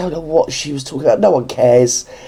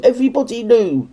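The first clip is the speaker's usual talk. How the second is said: ordinary delivery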